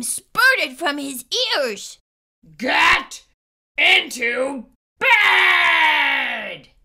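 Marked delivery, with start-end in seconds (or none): 2.6-6.7 loud, very slow monster bellow; thundering roar. A narrator's reading. Monster ordering Emma to bed, thundering bellow. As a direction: bellow it, stretching the last word. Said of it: "Gabe thundered".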